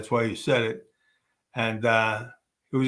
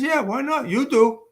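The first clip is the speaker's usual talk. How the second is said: much higher, playful comeback; pitched-up cheeky reply to Leo; cheeky retort; 13 semitones higher, 3 dB louder than usual. Retold or imitated. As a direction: toss it back at him, teasing.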